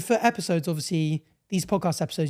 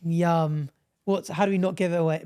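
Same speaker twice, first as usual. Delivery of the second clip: ordinary delivery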